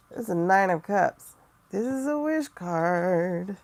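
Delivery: loud, slowing on wish card; excited reveal. Real, not acted. Showing viewers a wish card, loud and excited. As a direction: announce the good card loud and pleased.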